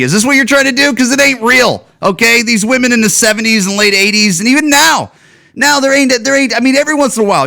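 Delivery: pitched high and pushing, fired up at the film; heated rant. Real, not acted. Ranting at the trailer, high and heated. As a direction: climb up high and let the outrage run.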